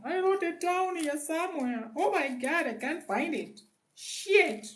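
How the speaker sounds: very high falsetto; playful high-pitched panic over lost notes; mock fretting. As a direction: whine to yourself in a squeaky falsetto.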